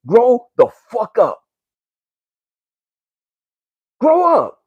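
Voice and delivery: furious command; angry yell. Real, not acted. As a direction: bark it at them. Yelling at the audience.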